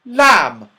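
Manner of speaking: loud, exasperated repeat; high, loud shout at a deaf friend. A narrator's reading. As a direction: shout it so a deaf man hears. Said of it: "shouted Silky".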